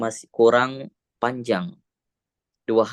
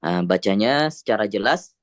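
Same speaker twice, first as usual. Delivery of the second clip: ordinary talk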